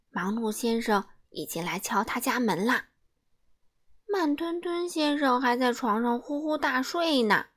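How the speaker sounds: ordinary delivery, nothing marked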